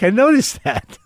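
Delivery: high playful gotcha; knowing mock remark. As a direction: needle him that you caught it. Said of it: "And I'm like".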